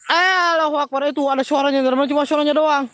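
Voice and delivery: loud, high, alarmed exclamation; startled alarm. Real, not acted. Host tells viewers of a voice heard, alarmed and loud. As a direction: cry out as if suddenly spooked.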